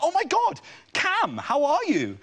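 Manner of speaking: high, drawn-out delighted hello; excited greeting. Retold or imitated. Comic retelling a street meeting with an old schoolmate, excited high greeting. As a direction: greet him in squealing, exaggerated surprise.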